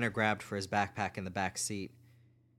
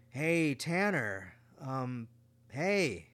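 put-on higher, slow, hesitant boy's voice; awkward bully greeting; 4 semitones higher, much slower than usual. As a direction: stall nervously before speaking. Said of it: "It was Carlos".

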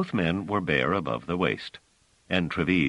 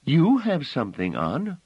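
put-on child voice; 11 semitones higher, 4 dB louder than usual. Phelim asking Bliss, high childlike voice. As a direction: play the small innocent girl, up high.

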